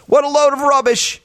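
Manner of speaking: high, indignant quoted outburst; quoting the dad. Retold or imitated. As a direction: throw it off like the fed-up dad. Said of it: "Then he says this".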